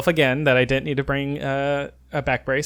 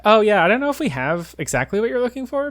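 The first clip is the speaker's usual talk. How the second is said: tag; 8 semitones higher than usual. Retold or imitated. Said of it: "like, perfect English, he's like".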